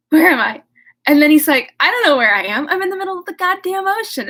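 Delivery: loud, rising mock panic, lost at sea; hammed-up panic. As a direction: play it as comic alarm. Frankenstein wakes lost at sea.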